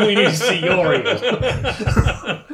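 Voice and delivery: high, slow, laughing through it; can barely get it out for laughing; giggling innuendo. Real, not acted. Player jokingly rephrases, laughing.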